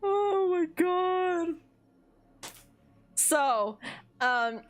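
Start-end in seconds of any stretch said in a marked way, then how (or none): 0.0-1.6 high, very slow sigh of words; drawn out, recovering from a giggle fit; spent from laughter. Real, not acted. Storyteller recovering from laughing, high and drawn out.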